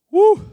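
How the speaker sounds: loud, high joyful whoop; preacher's happy shout; excited whoop. Real, not acted. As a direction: let out a real whoop of delight. Preacher thrilled by his own point.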